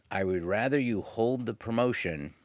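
normal talking voice

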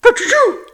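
high, loud squeaky rabbit sneeze; a sudden comic high sneeze. A narrator's reading. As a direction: burst out a little sneeze. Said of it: "Peter sneezed".

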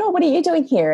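high, innocent mock surprise; feigned surprise. Friends chatting up a speaker at the bar, feigned surprise. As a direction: act as if you had no idea. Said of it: "pretended we had no idea".

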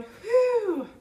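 a high, loud blown-out whew; loud exhausted whew. Retold or imitated. Preacher voicing exhaustion when everything flatlined, loud whew. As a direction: let out a big weary breath.

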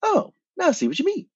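high, airy quote of Bart; mock impressed Bart. [name] quoting Bart to the host, high and airy. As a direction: play up his surprised interest. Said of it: "And he was like".

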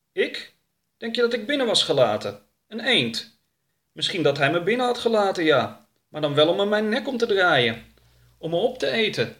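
high-pitched, protesting character voice; affronted and high; Oroka. Acted. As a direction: argue back in a high, animated voice.

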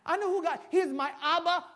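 rising, insistent pulpit delivery; preaching at full force. Acted. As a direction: preach it hard to the church.

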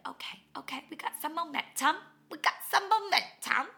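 from a whispered okay up to a high excited cheer; building mock hype. After her morning class, cheering herself on, building. Self-pep talk rising.

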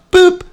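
high mock electronic beep; card reader beep. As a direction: chirp it like a card machine.

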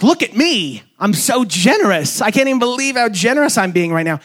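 high, showy bragging; mock self-congratulation. Mocking a self-conscious giver, high and showy. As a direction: show off your own generosity. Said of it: "It's not this, like".

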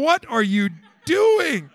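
loud slow cry of disbelief; exasperated shout. Real, not acted. Preacher rebuking husbands, loud slow exasperated shout. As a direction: shout the question at them.

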